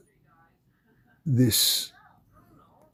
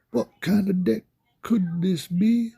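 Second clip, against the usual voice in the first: much higher, teasing game-show question; mock mystery voice; 10 semitones higher than usual. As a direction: tease the mystery card like a quiz host. Teasing mystery voice over music.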